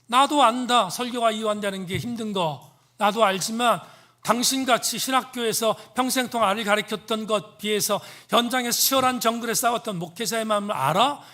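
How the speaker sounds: re-enacted offended pastor's outburst; indignant retort. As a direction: act out bristling back at the professor, loud and high. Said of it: "이렇게 빈정상했습니다".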